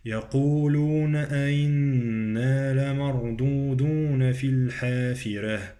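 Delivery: sustained recitation, long humming nun in "أئنا"; ghunnah on the nun. Shown as a demonstration. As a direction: recite with held vowels, nasal "أئنا". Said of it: "النون بغنة".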